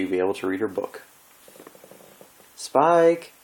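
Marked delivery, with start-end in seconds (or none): 2.6-3.3 high, loud call across the room. Acted. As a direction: call out to someone in another room. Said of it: "Twilight called".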